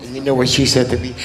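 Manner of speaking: normal talking voice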